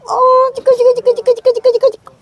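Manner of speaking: high sing-song chant; high playful cooing. Real, not acted. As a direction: coo the word over and over. Baby talk to goats.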